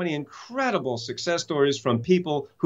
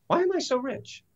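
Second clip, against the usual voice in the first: higher, softer asking; 10 semitones higher, 3 dB quieter than usual. Shown as a demonstration. Speaker demoing the new question to himself, higher and softer. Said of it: "What would the question be?".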